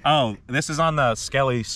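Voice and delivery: normal talking voice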